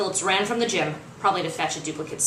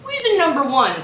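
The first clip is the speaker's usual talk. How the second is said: cocky showman; 7 semitones higher, 6 dB louder than usual. Acted. Creed's cocky video pitch.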